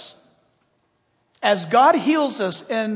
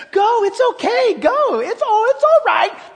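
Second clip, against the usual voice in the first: loud, much higher coaxing voice; mocking tempter voice; 13 semitones higher, 6 dB louder than usual. Preacher voicing Satan's false comfort, high and loud. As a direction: wave them on, falsely cheerful. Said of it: "The same voice that says".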